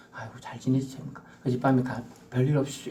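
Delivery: soft, gentle, sincere well-wishing; polite kind greeting. Shown as a demonstration. Preacher contrasting a kind greeting, soft and gentle. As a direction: greet them kindly. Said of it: "이런 게 아니고".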